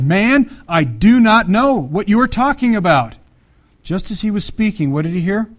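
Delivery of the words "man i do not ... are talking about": loud, slow, hammered words; vehement denial. Acted. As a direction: deny it with mock exasperation, stressing each word. Peter's vehement denial acted out.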